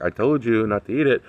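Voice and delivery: told you so. Retold or imitated. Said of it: "and I was like".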